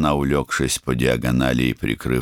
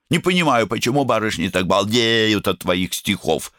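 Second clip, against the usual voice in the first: высокий ехидный голосок; подколи брата высоко; язвительная девочка; 8 semitones higher than usual. A narrator's reading. Сестра язвит. (ехидный девичий голос)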